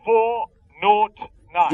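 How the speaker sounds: very slow, each digit set apart; slow dictation. Acted. Caller dictating the room number slowly over the phone. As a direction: spell it out slowly for writing down. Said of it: "Will you write it down?".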